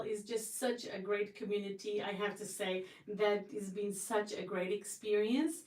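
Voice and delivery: usual speaking voice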